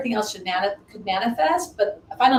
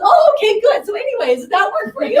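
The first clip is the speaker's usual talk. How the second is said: brisk relief; 13 semitones higher, 9 dB louder than usual. Retold or imitated. Client briskly resumes over the crying.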